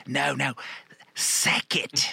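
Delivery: mock Australian, drawn out and rising high; spelling it out slowly in an Aussie accent; slowed-down Aussie. Retelling the woman repeating the street name, slow mock Australian. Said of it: "she slowed it down for me".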